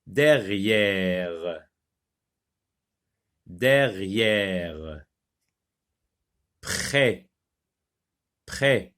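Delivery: slow, drawn-out single words with pauses; careful word reading. Shown as a demonstration. Teacher reading a word list for pupils, careful word reading. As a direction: read each word deliberately on its own. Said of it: "on va les lire d'abord".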